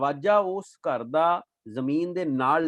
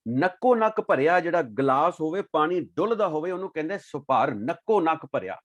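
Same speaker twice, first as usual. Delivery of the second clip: ordinary delivery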